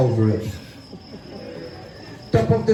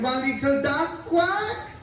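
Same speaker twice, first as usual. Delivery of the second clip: duck call; 5 semitones higher, 4 dB quieter, slower than usual. Acted. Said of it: "With audience participation, me first".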